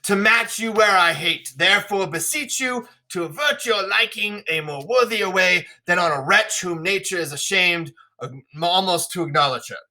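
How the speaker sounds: stagey royal disdain; disdainful King Lear. Acted. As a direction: declaim as a father disowning his daughter. Reads Lear disowning Cordelia.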